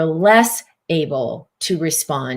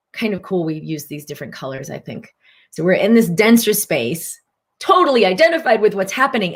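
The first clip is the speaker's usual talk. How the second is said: ordinary talk, nothing marked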